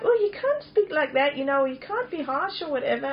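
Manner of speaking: high-pitched, fussy imitation of critics; mock objection. Speaker mimics people objecting to harsh words, high mock protest. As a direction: protest as a scandalized churchgoer would.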